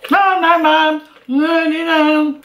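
put-on high whiny kid voice; high, whiny kids saying no; kids protesting. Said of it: "So I was telling my kids that".

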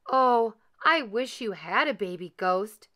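plain reading voice

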